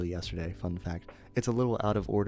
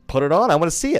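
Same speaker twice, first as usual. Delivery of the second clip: loud, higher eager demand; eager drunk dad; 8 semitones higher, 11 dB louder, faster than usual. Retold or imitated. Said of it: "and he's like".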